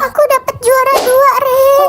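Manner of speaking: frightened. Acted. Winda telling Ren she came second, fearfully. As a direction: admit it as if dreading punishment. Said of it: "Kok kamu ketakutan gitu?".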